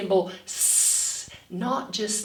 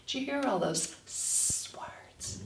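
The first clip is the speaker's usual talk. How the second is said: whispered aside to the class; 4 semitones higher, 10 dB quieter than usual. Acted. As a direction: lean in and whisper it like a secret.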